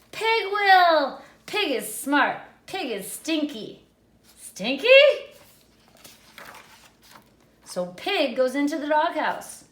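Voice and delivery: put-on high, loud picture-book reading for children; animated read-aloud. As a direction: read it big and playful for little kids.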